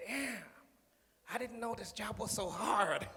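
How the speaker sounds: slow, lightly voiced surprised complaint; mock Trump dismay. Speaker mocking Trump finding the job hard, slow mock dismay. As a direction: sound caught off guard by the work. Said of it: "Donald Trump come up and said".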